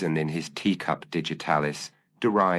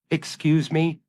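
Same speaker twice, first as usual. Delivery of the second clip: put-on much higher, anxious young man calling in; timid intrusion from the doorway, pitched up high; nervous young man; 12 semitones higher, 3 dB louder than usual.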